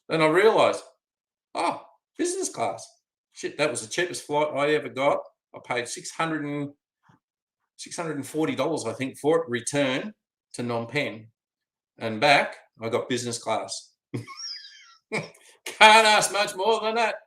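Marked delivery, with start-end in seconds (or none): 1.5-2.8 much higher, delighted discovery; happy shock at the upgrade; surprised realisation. Real, not acted. 14.1-17.2 slow, laughing delight; chuckling over the free upgrade. Real, not acted.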